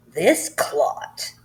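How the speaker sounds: loud, breathy booming insult. Acted. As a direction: boom it at the whole school. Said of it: "boomed the headmistress".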